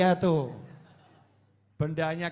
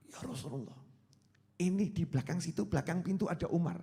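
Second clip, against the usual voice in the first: hushed, softer warning; alarmed companions; 7 dB quieter than usual. Acted. Frightened companion tells the Prophet who is at the door, hushed alarm. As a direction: warn the leader quietly, as if someone might overhear. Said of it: "sahabat geger".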